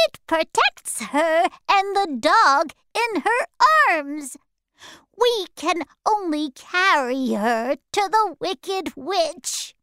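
high, odd-timbred creature voice; high monkey voice. Acted. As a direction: squeak it out like a flying monkey. Flying monkey speaks.

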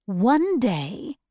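ordinary delivery, nothing marked

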